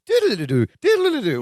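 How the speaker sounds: imitated wavy-screen effect. Co-host describing the screen going wavy, imitated dream effect. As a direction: mimic a wobbly flashback sound. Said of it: "The screen gets all".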